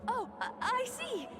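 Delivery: light up as it suddenly makes sense; excited realization. Acted. Guest realizes it was an act.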